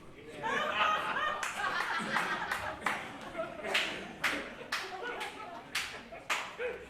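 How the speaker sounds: long high rising laugh. Real, not acted. Poet laughing after his ex-wife punchline, long laugh. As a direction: crack up at your own punchline.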